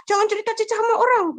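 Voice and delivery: quick, high-pitched mock scolding; mother's warning. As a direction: rush it out high, as a parent cautioning a child. Mother's warning voiced in story.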